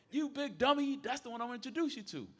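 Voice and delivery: high-pitched mock scolding; imitated exasperated friend. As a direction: tell off a clueless buddy in a high voice. Friend scolds him in retelling.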